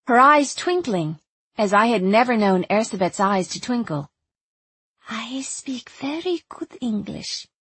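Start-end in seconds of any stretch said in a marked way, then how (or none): 5.1-7.5 put-on slow, accented secret whisper. A narrator's reading. Young woman confides her English in my ear, whispering. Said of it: "she whispered in my ear".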